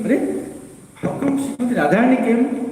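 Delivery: high, quick puzzled exclaim; Krishna startled, wondering aloud; surprised. Retold or imitated. Krishna hears the parrot and wonders aloud, high and surprised.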